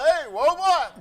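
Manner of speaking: loud high holler across the field. Retold or imitated. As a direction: holler it from way back in the bush. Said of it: "he was holler".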